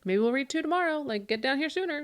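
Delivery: high, playful lecturing; mock stern mom. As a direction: tell the kids off in a sing-song way. Mother telling kids to hurry.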